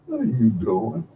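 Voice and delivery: low, questioning; voice of God. Acted.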